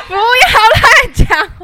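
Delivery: slow, loud protest through laughter; laughing protest. Real, not acted. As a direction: cry out while laughing.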